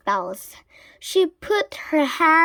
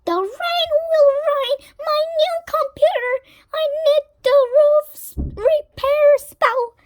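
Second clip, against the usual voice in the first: high, fast, louder witch voice; high fussing witch; 5 dB louder than usual. A narrator's reading. Winnie worried about her new computer, high fretful witch. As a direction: fret like a flustered witch.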